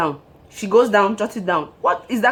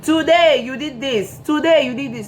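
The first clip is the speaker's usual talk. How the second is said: mock ledger-keeper; 6 semitones higher than usual. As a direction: read faults off a ledger. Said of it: "they bought a book".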